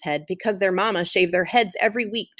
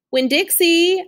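high, loud call through the yard; 8 semitones higher, 4 dB louder, slower than usual. Acted. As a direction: call for your dog you can't see. Calling dog in jungle yard.

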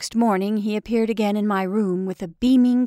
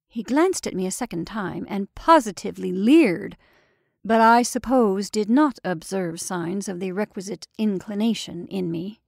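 usual speaking voice

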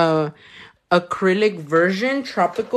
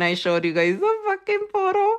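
much higher pitched, incredulous; exasperated disbelief; 13 semitones higher than usual. Acted. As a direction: rise up high in mock outrage. Viewers guessed the color.

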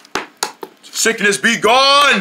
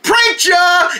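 gleeful prank reveal; 9 semitones higher than usual. Acted.

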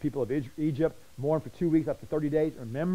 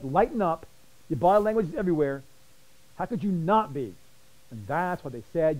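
ordinary talk, nothing marked

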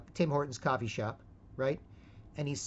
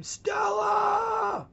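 huge, much higher anguished yell; Brando scream; 6 dB louder than usual. Retold or imitated. Boyfriend screaming for girlfriend like Brando, howling. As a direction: howl the name across the street. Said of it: "to the point where I'd scream".

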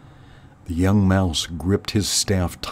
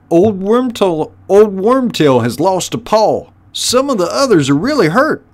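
high, loud underling voice; squeaky, high-pitched rat lackey; henchrat minion; 11 semitones higher, 6 dB louder than usual. Acted. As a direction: pipe up with a report to the boss. Henchrat reporting injuries.

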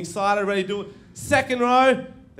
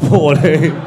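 louder incredulous laughing echo; laughing disbelief; 3 semitones lower, 10 dB louder than usual. Real, not acted. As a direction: echo the age through a laugh. Echoes a kid's age, laughing.

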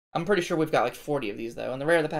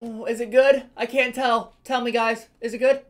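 excited; 5 semitones higher, 5 dB louder than usual. Real, not acted. Flips the last hit card.

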